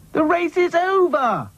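very high, carrying announcement; calling out. A narrator's reading. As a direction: call it across the field, high-pitched. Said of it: "called Dotty".

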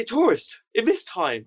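high, loud disbelieving challenge; incredulous Taliban guard. Retold or imitated. Taliban guard doubts the tourist story, incredulous and loud. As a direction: scoff at the idea of a tourist here.

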